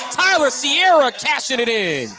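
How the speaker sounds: high, loud and slow; excited call. Real, not acted. Announcer celebrating the make at full volume. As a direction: let the made shot ring out. Basket follows the build-up.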